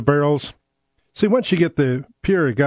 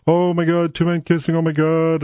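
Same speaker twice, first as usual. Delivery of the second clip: higher, mock-alarmed wail; mock panic; 3 semitones higher than usual. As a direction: imitate hysterical outrage, without real shouting. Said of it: "they start screaming".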